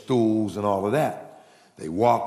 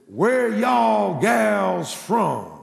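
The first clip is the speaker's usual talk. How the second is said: put-on higher, louder, very slow country-boy pickup line; country drawl; 10 semitones higher, 5 dB louder, much slower than usual. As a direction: stretch it out like a hopeful good ol' boy. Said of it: "he says".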